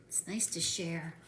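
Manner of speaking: put-on hushed whisper to the kids. Reader whispering to the children, hushed. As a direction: breathe it softly.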